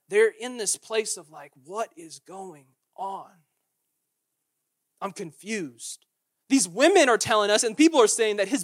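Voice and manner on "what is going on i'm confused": confused. Acted. Disciples' confusion voiced.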